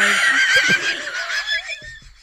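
very high, loud shrieking laugh. Real, not acted. Shrieking laugh as she tells her friend the classmate failed.

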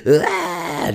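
a sudden high wail, mimicking his daughter bursting into tears; short, high mock crying burst; imitated toddler cry. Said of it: "just the tears, the cries".

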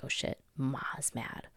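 slow, hushed cat caught misbehaving; soft little uh-oh as the guilty pet; sheepish cat. Retold or imitated. Cat realizing the owner is mad, sheepish and hushed. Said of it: "He's like".

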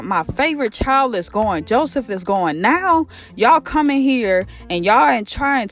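big-mad rant, ending on a high indignant question; acted upset father. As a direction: play a fed-up dad scolding. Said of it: "he so upset. He big mad".

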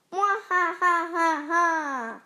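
staged villain "mwah ha ha"; squealed evil laugh. A narrator's reading. Evil pea gloating over the ice, squealed laugh. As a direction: laugh out mock-evil, syllable by syllable. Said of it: "squealed the evil P".

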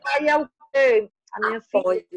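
ordinary talk, nothing marked

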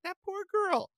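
high, pitying parent voice. Retold or imitated. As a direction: mimic a worried mom clucking. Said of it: "and they were like".

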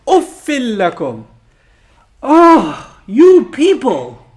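loud, high groan of disgust; acted exasperation at the whole crowd; exasperated disbelief. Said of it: "I can't believe you people".

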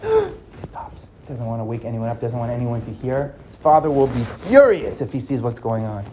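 soft, low, tense narration; hushed suspense. A narrator's reading. Narrator describing Mustafa sneaking out, hushed and tense. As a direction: tell it like you're sneaking past sleepers.

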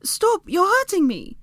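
high, loud protest in pain; pained cry. A narrator's reading. Hermione to wriggling Draco, crying out in pain. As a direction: cry out for it to stop. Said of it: "Hermione cried out".

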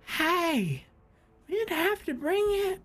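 very high, tipsy cartoon voice; drunk harpist. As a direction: pipe up like a happy drunk. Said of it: "this drunk, he's like".

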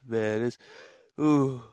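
ordinary talk, nothing marked

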